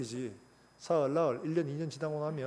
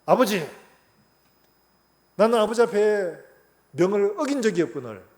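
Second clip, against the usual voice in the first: elder son's complaint; 4 semitones higher, 8 dB louder than usual. Retold or imitated. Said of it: "뭐라고 합니까?".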